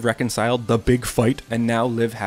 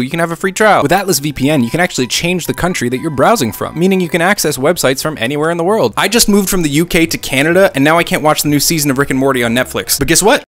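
loud, fast, pumped-up sponsor pitch; excited ad read; 4 semitones higher, 8 dB louder than usual. Acted. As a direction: sell it like a hyped YouTuber.

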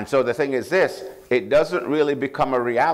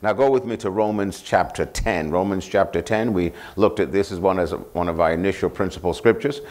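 ordinary talk, nothing marked

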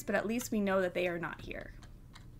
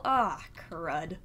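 ordinary talk

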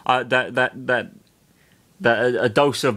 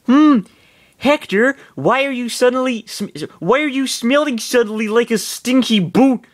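put-on high girl voice; 11 semitones higher, 4 dB louder than usual. Lynn voiced in read fiction.

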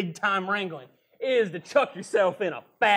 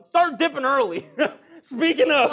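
laughing; 5 semitones higher, 6 dB louder than usual. Acted. Laughing cowboy.

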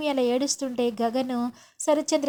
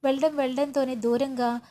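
usual speaking voice

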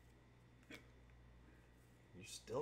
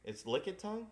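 surprised; 8 semitones higher, 8 dB louder than usual. Real, not acted. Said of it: "confused name surprise".

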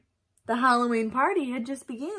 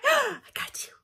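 gleeful gotcha; 13 semitones higher than usual. Pretend. Gleeful after the scare.

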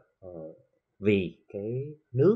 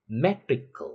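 "metrical" with a raised, punched MET; stress on MET; 4 semitones higher, much slower than usual. Shown as a demonstration. Teacher drilling the stress rule, MET stressed in "metrical". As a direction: hit the first syllable of "metrical". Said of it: "cách nó một vần về phía trước".

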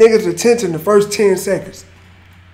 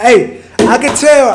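excited shout; 4 semitones higher, 6 dB louder, slower than usual. Real, not acted. Reactor hyped after hearing the freestyle.

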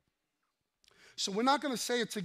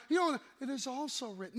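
firm pushback; 5 semitones higher than usual. Shown as a demonstration. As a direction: push back at someone coming at you. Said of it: "like he's coming at you".

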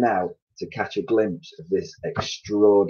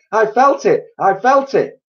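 high, loud shout; 8 dB louder than usual. A narrator's reading. Twit answering his wife, high loud shout. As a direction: yell it back in alarm. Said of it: "shouted Missus Twit".

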